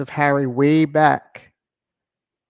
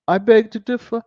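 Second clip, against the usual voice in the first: Southern dialect; 7 semitones higher, 6 dB louder than usual. Shown as a demonstration. To the viewers, mock Southern dialect raised and louder. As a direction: lay on the dialect, pitched up and louder. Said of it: "I try to do all the little dialect".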